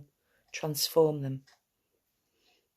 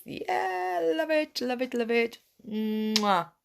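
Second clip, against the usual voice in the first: delighted gushing; 8 semitones higher, slower than usual. Real, not acted. Group leader gushing at goodbye.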